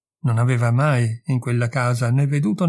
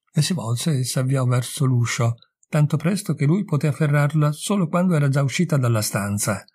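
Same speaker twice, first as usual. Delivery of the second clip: ordinary delivery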